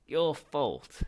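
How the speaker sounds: high, very loud screech. Acted. Ghost screeching blame at Zaphod, very loud. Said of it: "he screeched".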